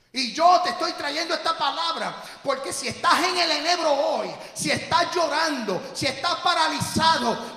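loud, high, impassioned shouting from the pulpit; fiery preaching. Acted. Pastor exhorting the congregation to rise, full-force preaching shout. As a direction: drive each line home like a battle cry.

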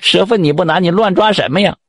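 pitched high, pained and alarmed; a snake-girl crying out in pain, betrayed. A narrator's reading. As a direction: yelp it out as the knife goes in. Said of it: "那白姑娘疼啊".

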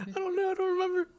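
squeaky panicked high voice. Real, not acted. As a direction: voice jumps way up under pressure. Panicked under quiz countdown.

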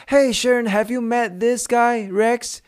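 high, slow, amazed calling voice; excited husband. Retold or imitated. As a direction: call your wife over in disbelief. Husband calls out to his wife. (丈夫惊奇地大声叫太太)

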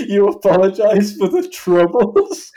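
loud, high, drawn-out disbelieving question; slow mock astonishment, rising at the end; incredulous echo. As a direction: throw it back as an outraged question. Irish bloke's incredulous reply.

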